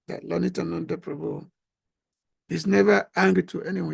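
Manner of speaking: ordinary delivery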